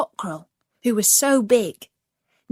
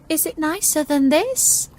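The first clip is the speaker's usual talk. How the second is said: doubtful; 7 semitones higher, 4 dB louder than usual. Acted. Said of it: "asked the duckling doubtfully".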